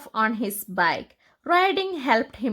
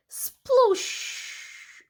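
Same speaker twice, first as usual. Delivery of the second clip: whooshing water spout, unvoiced; 13 semitones higher than usual. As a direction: blow out a breathy sploosh of spraying water. Whale spouting sound.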